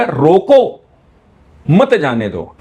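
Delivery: "रोको" alone, long silence, then "मत जाने दो" together; pause after रोको. Shown as a demonstration. Teacher showing the changed meaning, long pause after "रोको". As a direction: break hard after "रोको", join "मत" to "जाने दो". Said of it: "अब देखो इसका फर्क बदल गया".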